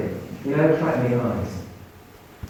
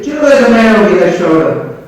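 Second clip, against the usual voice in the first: much louder and higher, proclaiming; loud announcement; 6 semitones higher, 10 dB louder than usual. Retold or imitated. Creepy geezer telling Lucy about her father, loudly. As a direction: boom it out as a grand revelation.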